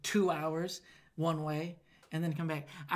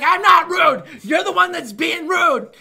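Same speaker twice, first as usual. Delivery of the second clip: angry retort; 11 semitones higher, 16 dB louder, slower than usual. Retold or imitated. Arguing with customer.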